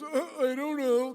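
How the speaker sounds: loud, high mimicking jeer; mocking parrot-back. Wife mocking her husband right back, exaggerated mimicry. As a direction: throw the words back in exaggerated mockery. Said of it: "She just mocked me right back".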